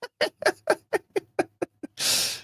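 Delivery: high, breathy burst of laughter; giddy laugh. Real, not acted. Fan reacting to a penalty decision, high giddy laugh.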